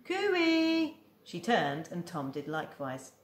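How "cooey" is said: high, louder hide-and-seek call; calling cooey. Acted. Boy calling out to Hattie from hiding, a high louder cooey. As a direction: sing out a high cooey from behind the trees. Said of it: "from behind the trees".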